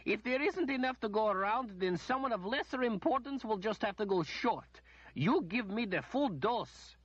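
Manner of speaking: high, imperious noblewoman voice; a pampered noblewoman demanding treatment first; haughty lady. A narrator's reading. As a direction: play the spoiled lady complaining. Lady demands full dose.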